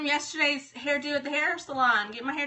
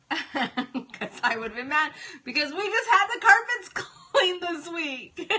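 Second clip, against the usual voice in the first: laughing; 4 semitones higher than usual. Real, not acted. Mom tells friends about her near miss, laughing as she talks. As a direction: talk through real laughter.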